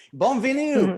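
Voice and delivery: playful French welcome; mock French greeting. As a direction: greet like a cheery local. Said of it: "sounds vaguely foreign".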